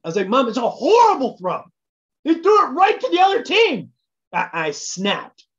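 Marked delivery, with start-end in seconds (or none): 0.3-3.9 higher, louder exasperated retort; retold snap. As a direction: bite back at mom in frustration. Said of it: "I turned to her and I snapped".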